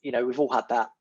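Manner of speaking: ordinary delivery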